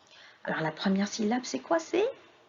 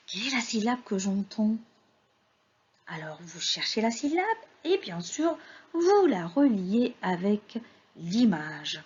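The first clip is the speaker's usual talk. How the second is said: ordinary talk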